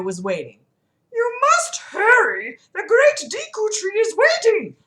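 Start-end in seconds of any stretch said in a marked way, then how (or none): 1.1-4.8 very high, loud cartoon voice; urgent Kokiri leader. Mido hurries the visitors onward, high urgent call. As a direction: squeal it out urgently, pitched way up. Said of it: "Mido said as he ushered us onward".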